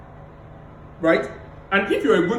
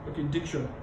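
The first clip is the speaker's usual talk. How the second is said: softer and lower, with a pause before diction; pausing on diction; 4 semitones lower, 9 dB quieter than usual. Shown as a demonstration. Coach recalling the pause before diction. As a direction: let diction land after a pause. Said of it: "I took like two seconds".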